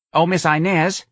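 high New Orleans call across a shop; New Orleans mother hailing the saleslady; New Orleans accent. A narrator's reading. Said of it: "called in that accent that occurs south of New Jersey, only in New Orleans".

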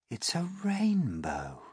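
high, slow wonder; awed exclamation. A narrator's reading. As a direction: breathe it out slowly in amazement. Everyone sees the rainbow.

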